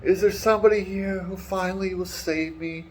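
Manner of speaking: high, pleading damsel voice; breathy damsel. Retold or imitated. Woman in tower greeting her rescuer, high hopeful plea. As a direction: play the rescued lady swooning. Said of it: "And she's like".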